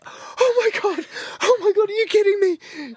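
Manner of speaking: excited squeal. Retold or imitated. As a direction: imitate her overjoyed shriek. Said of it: "she was like".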